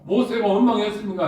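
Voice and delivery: ordinary talk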